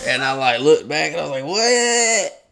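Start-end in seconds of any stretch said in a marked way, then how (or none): 1.5-2.5 high, sudden confused what; startled. Retold or imitated. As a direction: snap round in surprise. Startled retold reaction.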